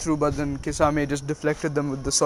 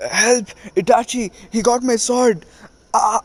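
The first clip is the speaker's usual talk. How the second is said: high, loud desperate yelling; hammed-up panicked plea to a partner; panicked cry for help; 7 semitones higher, 4 dB louder than usual. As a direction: shout for rescue, losing the fight. Kisame begging Itachi again.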